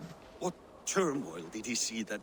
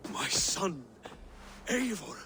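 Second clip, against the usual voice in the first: joyful greeting; 6 semitones higher than usual. Acted. Father joyfully greets returning children.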